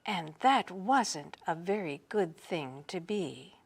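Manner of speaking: usual speaking voice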